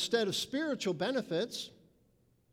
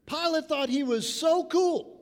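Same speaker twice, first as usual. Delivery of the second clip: mocking scorn; 6 semitones higher, 5 dB louder than usual. Mocking Pilate's self-importance.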